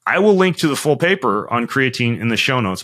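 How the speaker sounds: usual speaking voice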